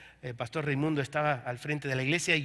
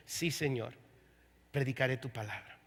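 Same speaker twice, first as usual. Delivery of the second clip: tearful surrender; 7 semitones lower, 8 dB quieter than usual. Retold or imitated. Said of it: "con lágrimas en los ojos, llorando".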